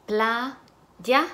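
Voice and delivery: Playa split as "pla... ya", lifting on the end; syllable by syllable. Shown as a demonstration. Teacher blending syllables for students, syllable by syllable. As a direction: break Playa into two separate syllables. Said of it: "van a segmentar sílabas".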